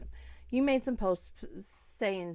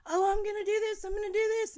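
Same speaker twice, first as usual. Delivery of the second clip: imitating the niece, high and loud; breezy, carefree niece in a high voice; mock niece; 11 semitones higher, 7 dB louder, faster than usual. Mocking the carefree niece to the camera, high and loud. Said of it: "She does this to me all the time".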